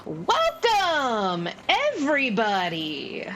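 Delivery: high-pitched, projected gnome announcer; booming little showman welcoming a crowd. Acted. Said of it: "bizarrely booming voice".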